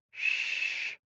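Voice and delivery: whispered shush; soft breathy hush; soothing shush. Acted. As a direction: hush him gently. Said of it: "Caleb soothes".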